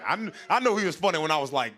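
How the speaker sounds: ordinary talk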